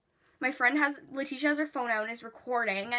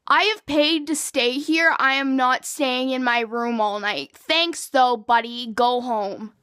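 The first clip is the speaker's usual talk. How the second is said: louder and slow, laying down the law; angry comeback; 8 dB louder, slower than usual. Retold or imitated. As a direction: push back hard and spell it out. Arguing back at security guard.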